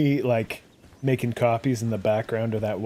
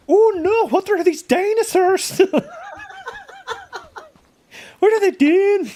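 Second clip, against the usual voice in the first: very high, loud startled Scottish lass; startled Scottish maiden; 8 dB louder than usual. Acted. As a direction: shriek it like a frightened Scottish maiden. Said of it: "The only Scottish accent I can do is some maiden who's startled".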